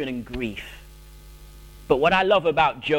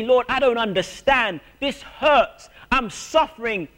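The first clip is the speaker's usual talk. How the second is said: pleading cry to God, pitched up; a hurting believer crying out to the Lord; anguished prayer; 8 semitones higher than usual. Pretend. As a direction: raise the voice in pained prayer. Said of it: "It's okay to say".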